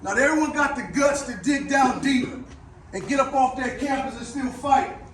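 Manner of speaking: loud rousing locker-room speech; halftime pep speech. Real, not acted. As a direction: fire up the team, rising to a shout. Building pep speech to players.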